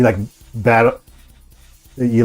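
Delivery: normal talking voice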